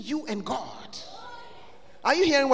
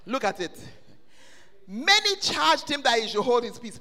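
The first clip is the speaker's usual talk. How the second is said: normal talking voice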